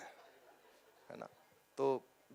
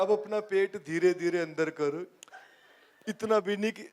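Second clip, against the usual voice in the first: Baba Ramdev impression. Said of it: "Baba Ramdev".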